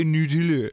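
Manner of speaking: Nutella with exaggerated oo in every syllable; silly Frenchified Nutella, all oo vowels; mock French Nutella. Accusing co-host of saying it fancy, mock French Nutella. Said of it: "you do say".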